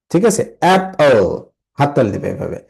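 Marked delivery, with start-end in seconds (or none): 0.6-1.4 "Apple" slow, weight on the first syllable; first-syllable stress. Shown as a demonstration. Teacher showing students the stress in "Apple". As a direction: stretch "Apple", leaning on its opening. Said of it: "the stressed syllables".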